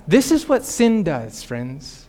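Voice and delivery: ordinary talk, nothing marked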